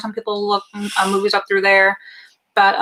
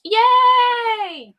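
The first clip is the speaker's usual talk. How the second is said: high, drawn-out yay; excited cheer; 12 semitones higher than usual. Real, not acted. Co-host celebrates the shirt, excited high cheer.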